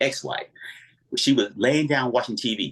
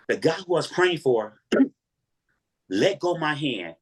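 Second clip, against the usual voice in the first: ordinary delivery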